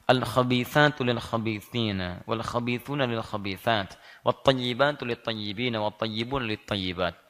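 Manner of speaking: slow, measured chanting of the verse; Quran recitation. Acted. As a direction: recite it slowly like tilawah. (bacaan ayat Quran yang pelan dan khidmat)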